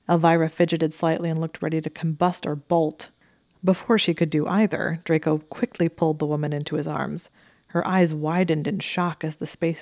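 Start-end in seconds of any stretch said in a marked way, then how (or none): none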